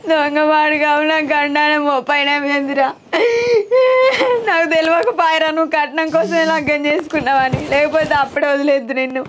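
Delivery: crying through the words; bitter complaint broken by sobs; weeping lament. Acted. Wife left alone, lamenting in tears. (ఏడుస్తూ వాపోవడం)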